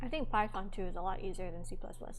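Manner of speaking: talking through a mouthful of food; eating while talking; mouth full. Real, not acted. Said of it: "talking with a mouthful".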